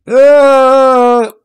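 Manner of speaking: a high, loud startled cry; real throat-catch yelp out of nowhere; sudden sharp yelp. Real, not acted. Streamer yelps at something stuck in the throat, sharp and high.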